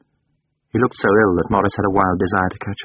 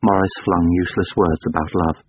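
ordinary delivery, nothing marked

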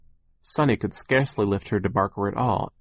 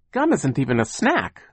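higher-pitched protest; indignant cry; 6 semitones higher, 3 dB louder than usual. A narrator's reading. Boy protests gum as a meal, crying out. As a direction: object in disbelief, pitch lifted. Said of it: "he cried".

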